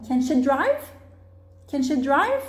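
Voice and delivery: "drive" emphasized with a rising tune; question stress. Shown as a demonstration. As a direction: model question climbing on "drive". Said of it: "nós damos ênfase".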